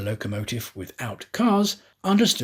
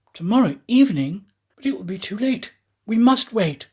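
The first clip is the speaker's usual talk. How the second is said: high, louder dismayed protest; dismayed Aouda; 8 semitones higher, 5 dB louder than usual. Acted. Aouda protesting to the conductor, louder, dismayed. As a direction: cry out against the delay.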